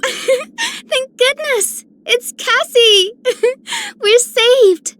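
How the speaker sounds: high-pitched giggling relief; cheer, giggling, as if rescued; giggly mock relief. Acted relief at Cassie arriving.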